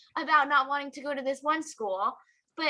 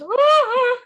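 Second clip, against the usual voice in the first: loud high wail imitating mom's sob; hammed-up crying noise; mock crying; 10 semitones higher, 10 dB louder than usual. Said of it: "when she cries, she goes".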